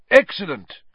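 loud, high exclamation of praise; delighted cry. A narrator's reading. Professor praising class as applause breaks out, crying out. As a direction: cry out your approval. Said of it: "cried Professor Lupin".

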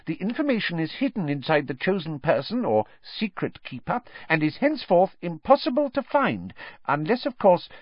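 high squeaky little professor. A narrator's reading. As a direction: explain it in a tiny, squeaky voice. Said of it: "he said squeakily".

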